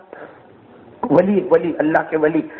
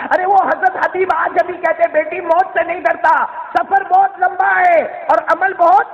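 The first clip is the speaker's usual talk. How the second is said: high-pitched, ringing proclamation; forceful, urgent sermon peak; impassioned preaching; 10 semitones higher than usual. Acted. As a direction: cry it out like a fired-up preacher.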